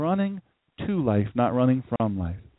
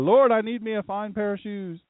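mock loud prayer; 7 semitones higher than usual. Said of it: "We say it loud".

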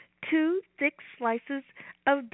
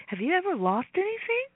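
ordinary delivery, nothing marked

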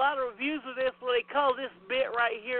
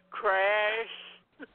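normal talking voice

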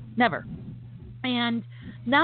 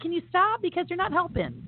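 exasperated retort; 9 semitones higher than usual. Retold or imitated. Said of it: "And I'm like".

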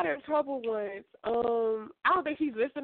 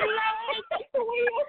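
high, laughing mock prayer; laughing plea; 10 semitones higher, slower than usual. Seeing her old teacher again, laughing despair. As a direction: cry to heaven through laughter. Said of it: "I was like".

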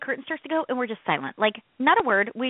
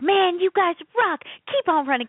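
mock DJ hype; 7 semitones higher than usual. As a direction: hype the runners up. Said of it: "we're like".

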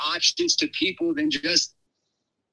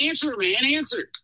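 retold urging; 7 semitones higher than usual. Best friend as the phone rings, urgently prodding. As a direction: pitch climbing, mock-urgent prodding. Friend urges him to pick up.

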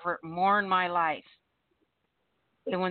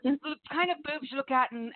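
ordinary talk, nothing marked

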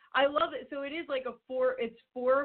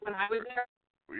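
cutting in and out, like a bad phone line; choppy cut off endings; 3 dB quieter, slower than usual. Real, not acted. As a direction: voice breaking up on a bad connection. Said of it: "You're cutting in and out".

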